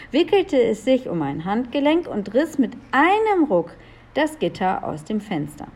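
ordinary delivery

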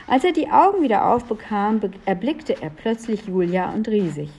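ordinary delivery, nothing marked

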